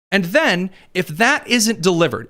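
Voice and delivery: ordinary talk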